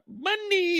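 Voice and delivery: high, squeaky sung-out money; talking credit card. Retold or imitated. The shining platinum card calling out, high and squeaky. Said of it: "That motherfucker said".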